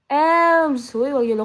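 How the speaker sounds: loud excited call to a friend. Real, not acted. Streamer calls out a viewer, loud and excited. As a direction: shout the name across the room.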